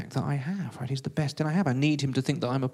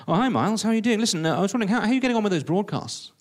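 high, loud, breezy radio producer; Peter Baxter; 8 semitones higher, 5 dB louder, faster than usual. Acted. Radio producer greets the struggling newcomer, high and breezy. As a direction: greet him in a lilting, hearty voice. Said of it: "Peter Baxter, the radio producer, the B B C radio producer".

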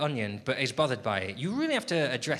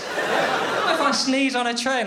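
ordinary talk

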